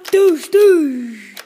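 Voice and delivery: two loud falling shot noises; imitated gunshots. Soldier shooting at a zombie, loud gunshot noises. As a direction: punch out two pretend blasts. Pretend firefight.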